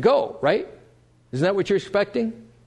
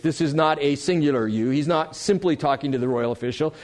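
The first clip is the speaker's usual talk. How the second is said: ordinary talk, nothing marked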